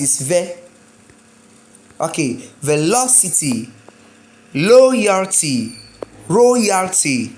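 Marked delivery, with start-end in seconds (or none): 2.6-7.3 "velocity, loyalty, royalty" with LO, LOY, ROY punched; third-to-last stress. Shown as a demonstration. Teacher drilling T Y words, punched stressed syllables. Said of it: "third to last too".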